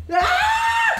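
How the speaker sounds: very high-pitched shriek; scream. Real, not acted. Telling the other to listen, a high scream first. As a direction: let out a short piercing scream.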